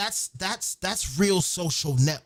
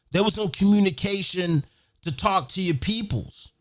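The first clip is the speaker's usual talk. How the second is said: normal talking voice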